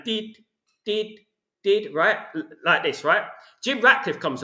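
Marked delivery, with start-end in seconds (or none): 0.0-1.9 imitated machine beep. Mimicking the life support monitor beeping by the bed. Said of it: "the thing is going".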